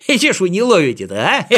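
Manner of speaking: fast, laughing taunt; teasing laughter. Acted. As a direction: giggle through the tease. Kolosok teases floating friends. (смеясь, дразнит)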